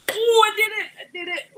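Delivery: loud triumphant cheer; excited. Real, not acted. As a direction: celebrate a small victory. Triumphant after opening jar.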